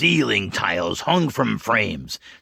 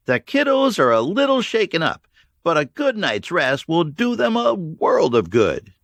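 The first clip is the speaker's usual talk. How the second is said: put-on higher, caring young woman's voice; voiced Bella, higher and gentle; 10 semitones higher, 4 dB louder than usual. A narrator's reading. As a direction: reassure the leader about the little ones.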